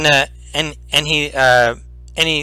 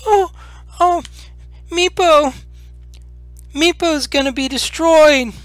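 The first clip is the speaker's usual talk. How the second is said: high squeaky little creature voice, upset; a frightened kobold wailing about his fate; whimpering kobold; 14 semitones higher than usual. Acted. Kobold Meepo wailing to the adventurers, high and upset. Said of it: "he's crying and".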